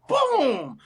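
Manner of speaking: high, drawn-out exclamation; imitated boom. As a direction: boom it out like a big highlight play. Imitating a spectacular throw.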